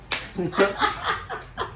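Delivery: high breathy laugh breaking in; burst of laughter. Real, not acted. Husband laughing while retelling the birth. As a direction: laugh helplessly at the memory.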